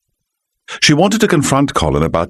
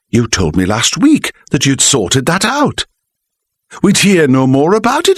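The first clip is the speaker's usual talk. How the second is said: higher wife's voice, anxious; worried wife; 8 semitones higher than usual. A narrator's reading. Wife's voice in story.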